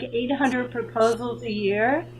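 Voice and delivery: usual speaking voice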